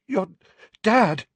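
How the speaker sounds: high, loud, broken by gasps; panting. A narrator's reading. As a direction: force the words out between heaving breaths. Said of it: "he panted, his chest heaving".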